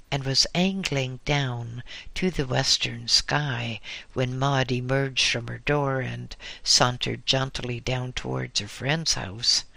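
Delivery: plain reading voice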